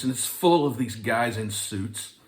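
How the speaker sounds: usual speaking voice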